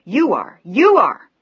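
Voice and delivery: loud, high screaming accusation; hysterical shout. A narrator's reading. Daughter screaming at her hypocrite father, hysterical. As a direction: scream it at him, out of control.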